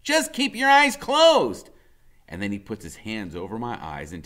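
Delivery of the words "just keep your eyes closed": high, slow and loud; a small boy playing guide, drawn out; Freak's voice. A narrator's reading. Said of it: "he says".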